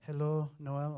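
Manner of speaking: put-on Mexican mother-in-law accent.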